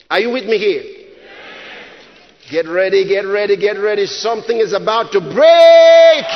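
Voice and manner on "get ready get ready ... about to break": low chant swelling into a long shout; building to a shout. Real, not acted. Preacher readying congregation for breakthrough, building to a shout. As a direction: build steadily, then hold "break" loud and long.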